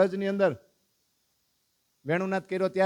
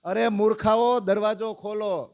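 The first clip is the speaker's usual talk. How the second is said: higher, louder command to open up; louder locked-in son demanding the door open; shouting at fools; 5 semitones higher, 4 dB louder than usual. Acted. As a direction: bang on the door with your voice. Son shouting at parents outside.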